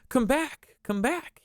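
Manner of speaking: high pleading yell; child yelling. Acted. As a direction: call after the birds, high and urgent. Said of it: "he yelled".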